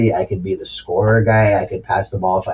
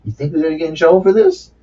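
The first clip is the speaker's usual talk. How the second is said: imitating Ron Artest; 5 semitones higher, faster than usual. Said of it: "Ron Artest said to him after".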